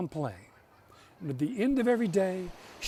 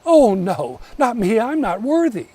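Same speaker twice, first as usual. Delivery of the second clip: put-on louder, higher humble girl; humble protest; 5 semitones higher, 11 dB louder than usual. A narrator's reading. Rough-faced girl refusing the sister, loud humble protest. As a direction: protest that she is unworthy, louder. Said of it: "She said".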